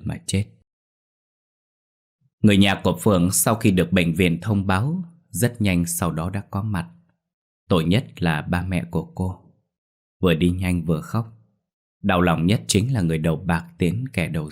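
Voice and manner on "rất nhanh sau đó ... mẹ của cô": plain reading voice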